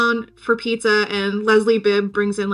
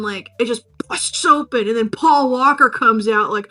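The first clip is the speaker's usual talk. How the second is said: ordinary talk, nothing marked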